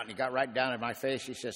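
usual speaking voice

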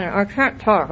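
ordinary talk

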